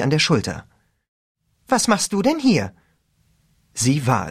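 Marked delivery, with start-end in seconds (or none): 1.7-2.7 lightly voiced teen girl; a higher girl's voice. A narrator's reading.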